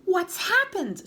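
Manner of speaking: high, slow frightened scream. Acted. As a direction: shriek it in alarm. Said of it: "screamed Missus Twit".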